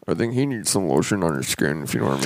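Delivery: low, sly innuendo in a villain voice; Buffalo Bill. Pretend. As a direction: drop deep for a creepy movie villain joke. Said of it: "Get roasted, Buffalo Bill".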